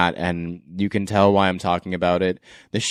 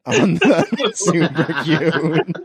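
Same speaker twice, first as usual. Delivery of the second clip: laughing through a request for the accent; 7 semitones higher, much slower than usual. Real, not acted. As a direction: giggle while asking for "super cute". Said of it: "that really bad accent".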